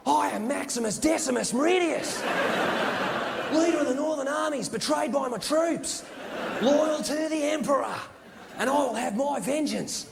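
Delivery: high, slow, hammed-up broad Australian wildlife host; Steve Irwin. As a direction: declaim drawn-out like a crocodile hunter acting an epic. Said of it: "Steve Irwin attempt to act".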